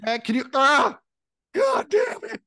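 high, strained, cracking cry; strained teen. Retold or imitated. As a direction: squeak it out mid-spasm, losing it.

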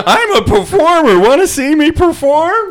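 bard voice. Pretend. Mocking bard voice.

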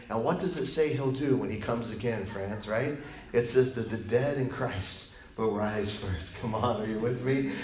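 ordinary delivery

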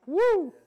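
high, loud mock whoop; whooping cheer. Mimicking the Pentecostals with a shrill whoop. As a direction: let out a wild Pentecostal whoop.

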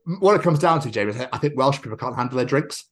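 ordinary talk, nothing marked

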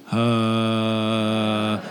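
low, held, neutral "uh"; sustained voice-placement hum. Shown as a demonstration. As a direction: hold one easy low note on uh. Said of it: "So everybody do this".

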